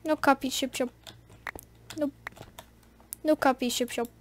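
lightly imitated Swedish accent; a hint of Swedish in short repeated phrases; PewDiePie. Gamer mimicking a favorite YouTuber, light mock Swedish accent. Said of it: "in a Swedish accent".

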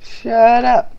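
loud, high, laughing yell; playful shout. Real, not acted. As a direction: yell it back at a teasing friend. Teasing about mods.

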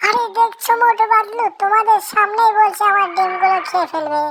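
indignant little bird crying out to Motu and Patlu; loud indignant complaint. Acted. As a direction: demand justice at full volume. Bird complains loudly about the witch.

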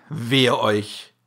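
plain reading voice

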